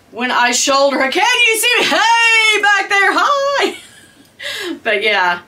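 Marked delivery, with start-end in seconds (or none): 1.1-3.8 shouting from across the room; high, loud call to the camera. Real, not acted. Calling out to camera far away.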